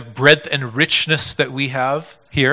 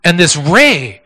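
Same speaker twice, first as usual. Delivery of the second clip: preaching at full force; 7 semitones higher, 9 dB louder than usual. Acted. Preaching climax.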